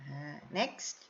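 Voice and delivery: ordinary delivery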